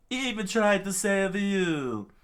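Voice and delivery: very high, loud outcry; impassioned yell. Acted. Chopper confronting the king over the doctor, impassioned yell. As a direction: cry it out in reproach.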